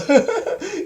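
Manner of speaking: amused laugh. Real, not acted. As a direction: burst of high laughter. Laughing at the plot.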